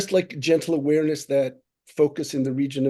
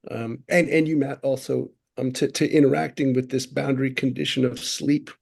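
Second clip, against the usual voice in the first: usual speaking voice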